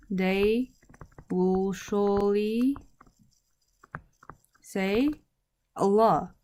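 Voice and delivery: slow, with long pauses between words; word-by-word dictation. Shown as a demonstration. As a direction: spell out the translation slowly for learners. Teacher repeats translation word by word.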